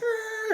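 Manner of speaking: high yelp of alarm; startled cry of passengers. Retold or imitated. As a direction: everyone crying out. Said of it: "everyone's like".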